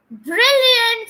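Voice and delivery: high, loud burst of praise; delighted praise. Pretend. Teacher praising students after their repeats, high delighted exclamation.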